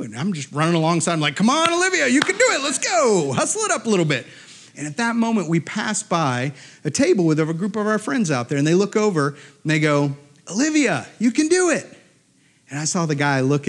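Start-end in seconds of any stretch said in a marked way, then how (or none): 1.4-4.3 high-pitched dad cheering her on; urging cheer. Retold or imitated. Dad running alongside his daughter, imitated urging cheer. 10.5-11.9 high call from the sidelines; imitated cheer. Friends at a table to Olivia, cheering call.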